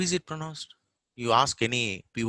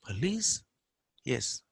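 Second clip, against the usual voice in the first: ordinary talk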